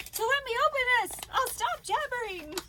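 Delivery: high, loud and rushed at first; eager excitement. Real, not acted. Vlogger about to open a parcel, eager and excited. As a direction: burst out, impatient to open it.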